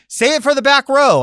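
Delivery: loud rallying shout. Retold or imitated. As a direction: project it to the back row. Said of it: "People always say".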